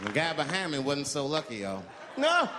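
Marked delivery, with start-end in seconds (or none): 2.2-2.6 mock anguished scream. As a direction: wail in disgust. Victim hit.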